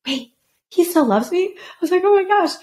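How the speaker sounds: high, rising wonder; high delighted disbelief; amazed disbelief. Retold or imitated. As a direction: gasp it, stunned he still cares. Disbelief he still loves her.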